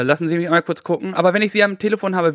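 ordinary talk, nothing marked

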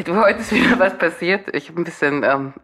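ordinary delivery

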